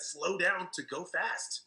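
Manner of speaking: drawn out very slowly, word by word; slow advice. Retold or imitated. Mentor repeating his key advice to Pat, very slow. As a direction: let each word land slowly.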